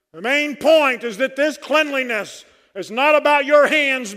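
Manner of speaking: building, loud and high, ending in a shout; preaching at full force. Acted.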